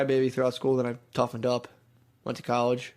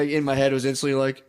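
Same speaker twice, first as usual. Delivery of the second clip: ordinary delivery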